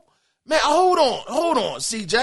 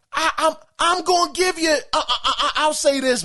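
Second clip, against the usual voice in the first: higher, flustered false starts; stuttering, stammering; 4 semitones higher than usual. Real, not acted. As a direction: trip over the opening words, half amused. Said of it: "You got me stuttering".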